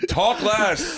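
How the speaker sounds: high mock call-out; pretend heckle. Heckler calling out to podcasters, high mock demand. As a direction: toss it at the stage, pitched right up.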